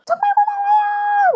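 very high, drawn-out thanks; piercing, slow thank-you call; imitated shouted thanks. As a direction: yell gratitude out of a car window. Friend yelling thanks from window.

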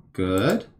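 usual speaking voice

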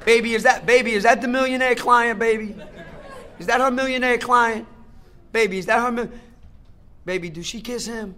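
hammed-up jealous interrogation of wife; mock jealous husband. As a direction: needle her like a suspicious husband. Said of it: "I'll be like".

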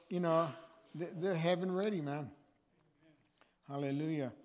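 ordinary delivery

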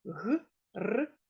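Г-р-и-б each sound separate. Shown as a demonstration. Teacher sounding out a word for pupils. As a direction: spell Гриб sound by sound. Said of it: "Гриб".

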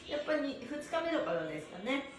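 ordinary delivery

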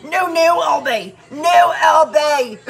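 hammed-up yell at the screen, stretched slow; mock shout. Scolding the BTS member in a video, loud mock yell. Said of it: "I'm like".

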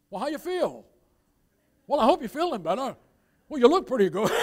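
loud, high, hearty blurting; booming clumsy sympathy; tactless father. Retold or imitated. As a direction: imitate a dad with no shame. Tactless father consoles girl.

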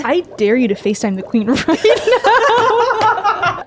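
laughing. Real, not acted. Laughing while reading dare.

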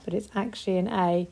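ordinary talk

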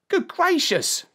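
put-on very high-pitched boy's exclamation; shocked boy. A narrator's reading. Boy hears of the princess's fate, high shocked voice. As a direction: gasp it as an astonished lad.

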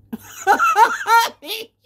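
a burst of high cackling laughter. Real, not acted. Laughing after threatening everyone, high cackle.

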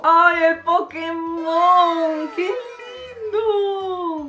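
high, slow squeal of delight; cooing delight. Real, not acted. Seeing cute Pokémon painting, a slow high squeal. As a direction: melt over something cute, stretching every word.

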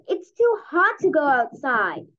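plain reading voice

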